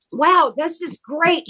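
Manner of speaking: high, drawn-out wonder; impressed wow, pitched high and slow; retold admiration. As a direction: marvel slowly, imitating an impressed admirer. Acts out Stone admiring costume.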